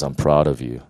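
God speaking to his son, low and tender; God's tender voice. Retold or imitated. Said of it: "He says".

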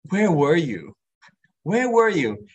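rising, pleading where were you; a hurt sister asking where he was; hurt plea. Retold or imitated. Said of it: "She's saying".

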